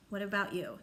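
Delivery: usual speaking voice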